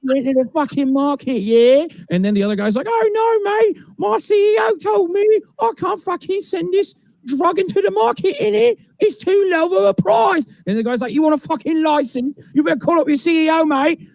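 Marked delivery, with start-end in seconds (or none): 0.0-1.9 put-on high, cheeky London geezer accent; mock British accent haggling with a drug rep. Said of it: "imagine if they're speaking, uh, English in the U K". 2.9-10.4 put-on much higher, shouting London accent; shouted mock British accent. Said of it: "they're speaking in English in the U K". 11.1-14.0 shouted mock British accent. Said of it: "they're speaking in English in the U K".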